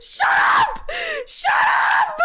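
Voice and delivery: very high, loud screech; laughing shout. Real, not acted. Streamer teased mid-laughing fit, shrieking protest. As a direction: screech it, cracking up. Yelling through laughter at teasing.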